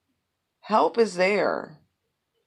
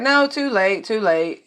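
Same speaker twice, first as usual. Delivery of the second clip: mocking too late; 5 semitones higher, 7 dB louder than usual. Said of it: "And they're like".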